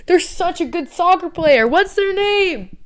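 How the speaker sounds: high, dreamy, slow ditzy voice; high ditzy fan voice; airheaded fan. Retold or imitated. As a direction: play yourself clueless about names. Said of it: "I'd be like".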